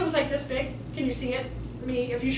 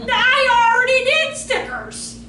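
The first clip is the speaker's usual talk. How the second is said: loud, high, drawn-out mock outburst; exasperated protest; 9 semitones higher, 9 dB louder, slower than usual. Teacher rejecting sticker advice, loud exasperated protest. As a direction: belt it out slowly in acted frustration. Imagined angry reply to useless advice.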